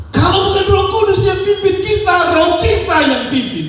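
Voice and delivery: high, loud preacher's cry; preaching shout. Real, not acted. Preacher warning congregation, high full-force shout. Preacher driving home the point.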